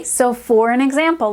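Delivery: ordinary talk, nothing marked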